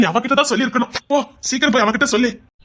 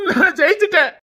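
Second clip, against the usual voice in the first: higher, bursting cry of victory; tearful triumph; 6 semitones higher than usual. Acted. Husband telling his wife he finally won, bursting with tearful joy. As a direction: let the joy break out.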